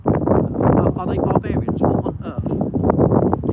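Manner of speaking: a doctor appalled by British treatment; shocked doctor. Retold or imitated. Doctor exclaims in loud shocked disbelief. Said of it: "He said".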